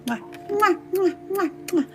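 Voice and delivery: quiet high kissing sounds; forehead kisses. Pretend. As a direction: blow sweet kisses to the viewers. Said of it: "have some forehead kisses".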